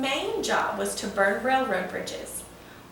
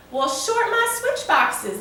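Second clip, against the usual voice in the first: amazed exclamation; 9 semitones higher, 6 dB louder than usual. Acted. Big John amazed by the plan.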